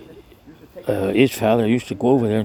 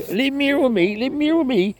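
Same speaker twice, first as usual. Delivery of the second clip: retold shout; 13 semitones higher than usual. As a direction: call after the departing wagon, high and half-hearted. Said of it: "she waving and shouting".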